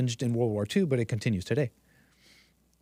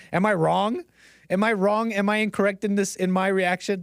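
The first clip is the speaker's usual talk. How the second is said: challenging; 6 semitones higher than usual. Real, not acted. Challenging the audience.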